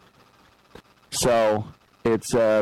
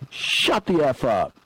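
higher, slow, sharp retort; mock angry retort; 7 semitones higher than usual. Ref telling off a mouthy player, mock angry. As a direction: snap it back like a fed-up ref. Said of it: "And he's like".

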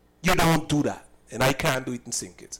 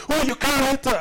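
higher, mock-indignant objection; imitating a protesting bystander; 9 semitones higher than usual. Retold quarrel, another woman objecting.

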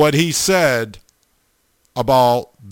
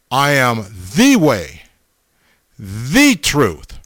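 each "the" drawn out as "thee" with a pitch swoop; stress on THE; 3 semitones lower, slower than usual. Shown as a demonstration. Preacher reminding listeners of the verse, stressing each "the". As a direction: lean hard on every "the", pausing between phrases. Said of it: "look at the emphasis on the word".

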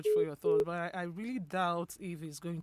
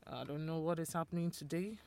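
ordinary talk

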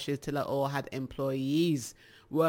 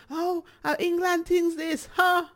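much higher Jamaican patois exclamation; Jamaican local; 13 semitones higher, 4 dB louder than usual. Retold or imitated. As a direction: imitate an excited Jamaican customer. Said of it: "when they hear the accent".

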